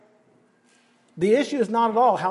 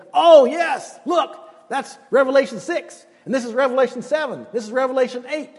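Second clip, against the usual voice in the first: high, quick, eager listing of chapters; excited counting off; 4 semitones higher than usual. Retold or imitated. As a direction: cheer each chapter like a countdown.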